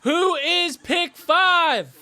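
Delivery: high, loud, drawn-out announcer build-up; hyped anticipation. Real, not acted. Streamer waiting on who is pick five, hyped. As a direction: call it out big, stretching the final word.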